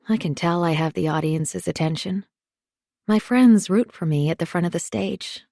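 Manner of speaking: plain reading voice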